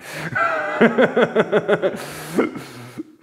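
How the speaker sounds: loud, high burst of real laughter; big laugh. Real, not acted. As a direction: crack up at your own failed whistle. Laughs at her own whistling.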